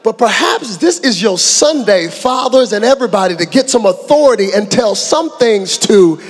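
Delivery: loud, rising preacher's call; preaching at full force. Real, not acted. Preacher stirring up the Sunday crowd, full force. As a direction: fire up the congregation.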